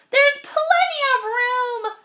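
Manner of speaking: high, cheery bug voice; a little bug laughing at the bird. Acted. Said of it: "laughed the bug".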